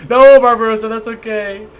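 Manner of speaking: exaggerated reassurance; consoling. Real, not acted. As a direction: call it out sympathetically, starting loud. Consoles self-deprecating player.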